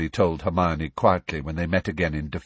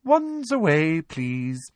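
smug teacher; 7 semitones higher, much slower than usual. A narrator's reading. Umbridge instructing her class, smugly polite. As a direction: order it with sugary, prim politeness.

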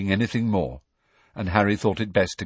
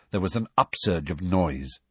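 ordinary delivery, nothing marked

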